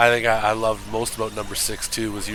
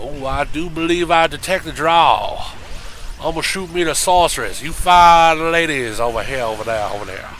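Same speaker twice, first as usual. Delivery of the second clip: lightly voiced New Orleans accent; Gambit impression; 3 semitones higher, 4 dB louder than usual. Asking if the Cajun was Gambit-style New Orleans. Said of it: "Like Gambit from X-Men".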